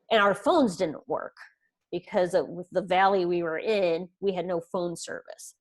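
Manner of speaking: normal talking voice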